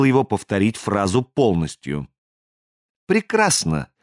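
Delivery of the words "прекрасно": much higher, pleased exclamation; light up with satisfaction, pitch leaping; delighted. A narrator's reading. Stranger hears both brothers agree, delighted. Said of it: "Чужак даже расцвёл".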